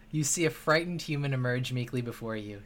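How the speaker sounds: ordinary talk, nothing marked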